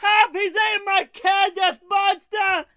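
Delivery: high, slow hammed-up yell for help; mock panicked scream. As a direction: wail it like a man terrified of a monster. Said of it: "imitating the screaming neighbor".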